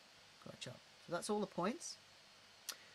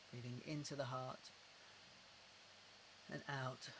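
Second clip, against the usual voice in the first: guided breathing cue; 2 semitones lower, 7 dB quieter than usual. Shown as a demonstration.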